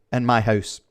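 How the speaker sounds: normal talking voice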